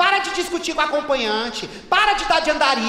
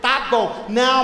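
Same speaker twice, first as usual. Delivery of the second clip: normal talking voice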